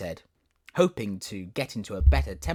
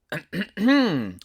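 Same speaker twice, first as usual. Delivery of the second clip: throat clearing; 10 semitones higher, 8 dB louder than usual. Acted. Tiger lily about to speak.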